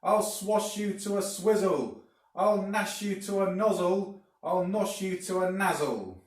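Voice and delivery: high, loud raging hag voice; furious shout. A narrator's reading. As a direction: scream threats while slashing with the stick. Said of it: "she shouted".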